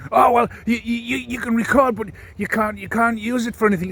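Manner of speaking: high, whiny mock of a flustered woman; mocking the flustered assistant. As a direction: squeak it out like she's panicking.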